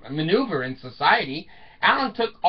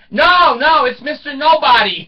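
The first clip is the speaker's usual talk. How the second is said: cheeky daughter; 8 semitones higher, 8 dB louder than usual. Retold or imitated. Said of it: "And she goes".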